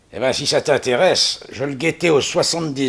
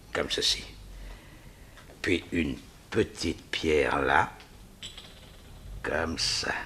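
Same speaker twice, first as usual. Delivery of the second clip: breathy aside under his breath; a hushed, absorbed whisper; whispering to himself; 7 semitones lower, 10 dB quieter than usual. Acted. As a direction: mutter it to yourself while you work. Villain works the stones alone.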